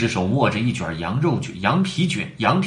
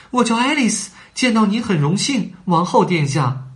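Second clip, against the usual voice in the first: polite; 9 semitones higher than usual. A narrator's reading. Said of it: "爱丽丝很有礼貌地回答".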